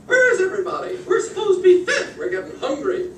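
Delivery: high, loud squawking hens; chickens. Acted. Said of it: "all the chickens says".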